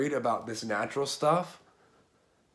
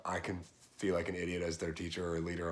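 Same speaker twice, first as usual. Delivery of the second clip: ordinary talk, nothing marked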